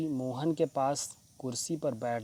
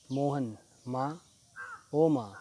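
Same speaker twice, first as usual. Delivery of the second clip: put-on a reader's groan of a sick boy, calling out for his mother; groaning in pain. A narrator's reading. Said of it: "Mohan ज़ोर से कराहता है".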